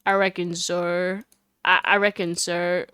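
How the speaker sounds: halting, half-hearted Southern mimicry; mock country accent. Answering Lotta about the cops, mock country accent. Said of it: "try to mock my accent".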